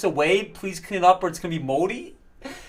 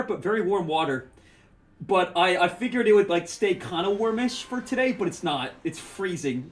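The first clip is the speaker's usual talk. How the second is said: ordinary talk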